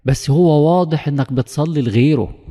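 preacher scolds the worshipper, loud and slow; stern rebuke. Real, not acted. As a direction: bring it down hard on the listener.

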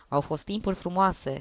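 usual speaking voice

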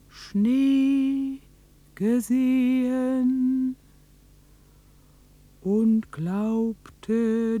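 slow, measured storyteller voice with long pauses; slow story narration. Acted. Narrator tells of the winter miracle, slow and solemn. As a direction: tell it like a solemn legend, word by word.

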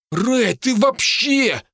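high, drawn-out indignant outburst; high-pitched indignant protest; shaken outrage. Acted. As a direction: berate a friend in a high, rattled voice. Shaken Hinnigan berates his friend.

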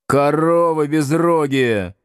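drawn-out growled insult; spiteful growl. A narrator's reading. Pupil insults the girls who exposed him, growling spitefully. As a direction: growl it slowly, full of spite. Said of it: "злобно прорычал Градусов девицам".

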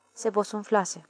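ordinary delivery

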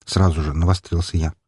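usual speaking voice

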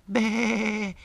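high loud bleat; goat bleat. Acted.

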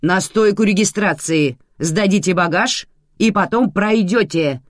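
loud, high barked instructions; irate official shouting; barking. A narrator's reading. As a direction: bark it at a slow passenger. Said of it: "рявкнула женщина".

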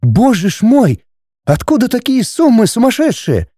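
high-pitched shocked exclamation; bewildered alarm. A narrator's reading. Wife appalled at the sum, alarmed. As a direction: gasp at it in dismay. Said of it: "растерянно и испуганно".

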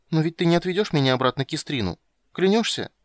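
put-on high, urgent little-girl plea; anxious child. A narrator's reading. Girl asks witcher not to return her, anxious. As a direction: plead high, like a worried child.

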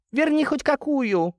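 very high, slow mother voice; sceptical mother. Acted. Mother answering her son's promise about the car, sceptical. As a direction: draw it out high, doubting his promise.

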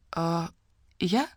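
small, taken-aback question; hesitant, quiet surprise; surprised. A narrator's reading. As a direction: ask it softly, caught off guard. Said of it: "удивилась Вася".

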